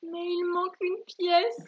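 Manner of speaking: high, slow, plaintive character voice; drawn-out, acted disappointment; sad child badger. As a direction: lament it as a little badger. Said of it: "répondit tristement l'enfant le blaireau".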